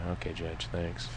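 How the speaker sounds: meek reply. Retold or imitated. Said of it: "I said".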